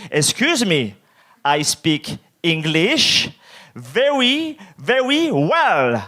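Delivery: exaggeratedly drawn-out English, word by word; painfully slow, over-enunciated English; very slow insistent English. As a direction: stretch every word with big swoops. Cutting in again, more insistent.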